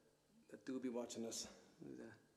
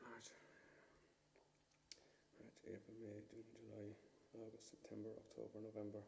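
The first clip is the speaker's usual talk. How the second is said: much quieter, low murmured count; counting to oneself; 8 semitones lower, 24 dB quieter, faster than usual. Real, not acted. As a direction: tick off the months under your breath. Counting off months to himself.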